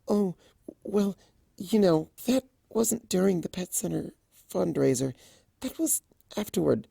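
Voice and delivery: high, soft and timid; blushing mare correcting her friend softly; embarrassed Fluttershy. Acted. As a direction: shrink away while explaining.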